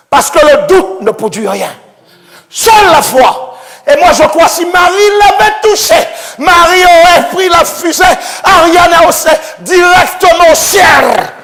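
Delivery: loud, high preacher's cry; fervent preaching. Acted. Preacher to congregation about faith, fervent shout. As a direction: proclaim faith to the crowd.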